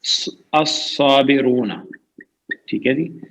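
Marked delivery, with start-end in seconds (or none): 0.0-1.7 الصابرون said slowly, every syllable drawn out; slow careful reading. Shown as a demonstration. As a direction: spell out الصابرون slowly for students. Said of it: "teacher models word". (الصابرون کو آہستہ آہستہ واضح پڑھیں)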